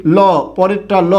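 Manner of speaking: ordinary talk, nothing marked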